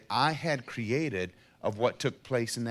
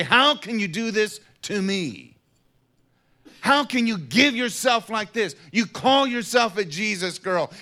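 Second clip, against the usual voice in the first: higher, louder accusing cry; accusing in judgment; 9 semitones higher, 7 dB louder than usual. Retold or imitated. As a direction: hurl the judgment at her. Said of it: "stand in judgment and say".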